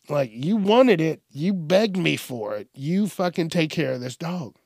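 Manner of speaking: ordinary talk, nothing marked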